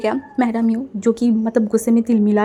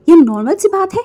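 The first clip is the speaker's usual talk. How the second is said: higher, louder, incredulous echo; outraged disbelief; 7 semitones higher, 7 dB louder than usual. Acted. Mother scoffs at Wang's calm excuse, outraged and loud. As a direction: throw the words back in outrage.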